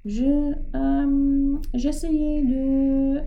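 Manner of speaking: hésitant, haché, plus aigu et lent; bégaiement. Acted. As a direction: Georges bégaie, pris en faute. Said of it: "bégaya Georges". (balbutiement aigu et ralenti)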